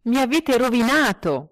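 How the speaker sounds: high, loud cry of despair; a ruined man shouting at the taxi driver; furious shout. Acted. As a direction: yell it at the driver, beside yourself.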